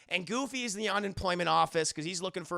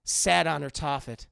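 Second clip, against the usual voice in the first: mock bad poetry; 4 dB louder than usual. The poet reciting bad verse to the café. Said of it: "shitty version of poetry".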